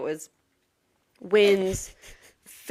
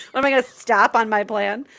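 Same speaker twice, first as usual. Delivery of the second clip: usual speaking voice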